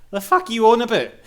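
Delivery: imitated gamer scoffing loudly at a bad review; incredulous outburst. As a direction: fire back in disbelief at the reviewer. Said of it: "listening to that like".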